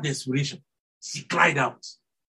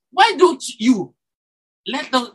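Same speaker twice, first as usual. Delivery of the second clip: normal talking voice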